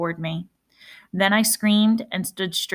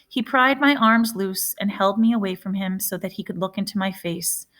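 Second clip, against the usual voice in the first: ordinary delivery, nothing marked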